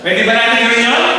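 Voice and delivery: ordinary talk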